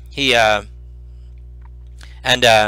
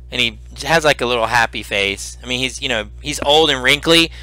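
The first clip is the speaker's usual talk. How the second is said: ordinary talk, nothing marked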